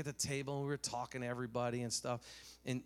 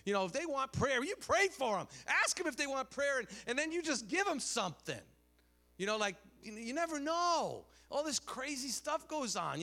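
high-pitched, exhorting preacher; fired-up preaching; 11 semitones higher, 5 dB louder than usual. Real, not acted. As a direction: urge the congregation on with rising force. Urges congregation to pray.

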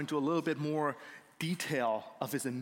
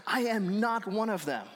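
raised-pitch, indignant retort; angry denial; 6 semitones higher, 4 dB louder than usual. Retold or imitated. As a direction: act out an emphatic, angry denial. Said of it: "He is so angry".

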